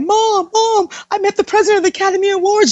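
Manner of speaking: high, rushed and breakneck; excited news to mom. Retold or imitated. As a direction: gush it to mom in one excited burst.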